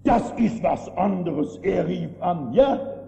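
loud, slow climax; preacher at full force against book prayers; full-force preaching. Acted. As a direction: thunder it out, slowly.

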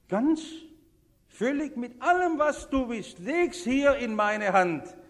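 loud, high, slowing exhortation; full-force preaching. Acted. Preacher voicing Jesus' call to surrender, full-force preaching. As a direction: proclaim the command with full force.

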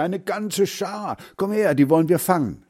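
put-on high, urgent boy's call; rally a friend to the chase; excited calling. A narrator's reading. Heinz urges his friend to catch ducks, calling excitedly. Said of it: "rief er".

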